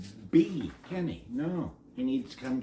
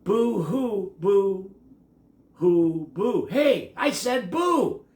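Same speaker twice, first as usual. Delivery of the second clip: high, loud and rising frustration; building shout; 7 semitones higher, 8 dB louder, much slower than usual. A narrator's reading. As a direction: push each boo louder until he snaps. Ben getting louder, fed up.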